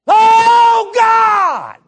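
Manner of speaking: very high, full-volume scream to God; screaming prayer. Retold or imitated. Preacher imitating a kid praying to be saved, screaming out loud. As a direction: cry out at the top of your voice, imitating a desperate convert. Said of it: "This kid starts screaming".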